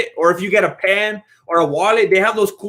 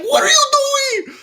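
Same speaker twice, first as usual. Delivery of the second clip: high, slow, startled yell; the manager catching him red-handed, mock-shouting high; shocked manager shout; slower than usual. Manager yelling at a worker in shock.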